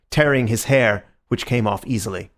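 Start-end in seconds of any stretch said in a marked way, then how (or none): none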